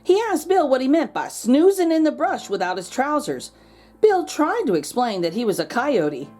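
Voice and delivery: plain reading voice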